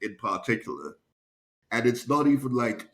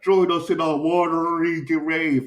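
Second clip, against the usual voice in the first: high, loud spooky ghost voice; ghostly wail; 8 semitones higher, 5 dB louder than usual. Retold or imitated. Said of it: "what if the ghosts could talk".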